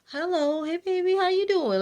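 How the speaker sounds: very high, sing-song grandma hello; grandma greeting. Retold or imitated. Grandma welcoming the new partner, very high and gushing. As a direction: gush like a delighted grandmother.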